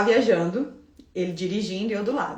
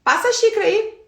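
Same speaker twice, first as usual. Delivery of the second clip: curt, commanding request, high and loud; imperious order to the boyfriend; bossy order; 10 semitones higher, 5 dB louder than usual. Retold or imitated. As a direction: snap it out like a boss giving an order. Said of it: "do meu jeito".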